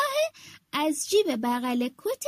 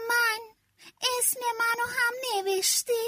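higher, lightly voiced eager pigeon; 6 semitones higher than usual. Pigeon asking the fox if its name is written, high and eager. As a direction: ask hopefully like a small bird. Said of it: "کبوتره ... گفت".

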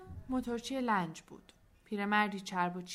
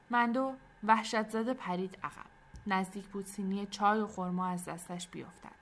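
plain reading voice

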